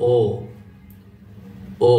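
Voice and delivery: "ও" with rounded lips. Shown as a demonstration. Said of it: "ঠোঁটকে round করতে হবে". (ঠোঁট গোল করে "ও")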